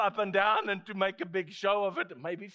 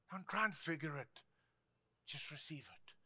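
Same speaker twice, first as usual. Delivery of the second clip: soft reply dropping to near nothing; calm hushed answer; 2 semitones lower, 12 dB quieter than usual. Retold or imitated. Preacher answers the baffled doubter, calm and hushed. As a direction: settle the question gently and quietly.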